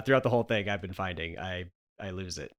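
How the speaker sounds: ordinary delivery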